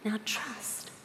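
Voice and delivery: a hushed, whispered charge; whispered command. Retold or imitated. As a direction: whisper it like a sacred secret. The Lord's words end hushed.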